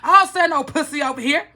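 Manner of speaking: retold angry yelling. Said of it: "So I'm like".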